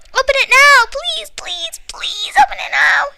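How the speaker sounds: put-on boy begging to open the present. A narrator's reading. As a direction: beg eagerly like a child. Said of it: "Jack begged".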